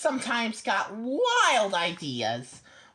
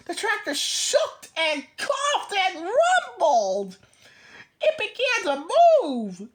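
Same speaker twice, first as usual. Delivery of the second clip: excited; 7 semitones higher, 4 dB louder, slower than usual. A narrator's reading. Tractor starts up in story.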